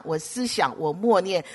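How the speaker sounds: ordinary talk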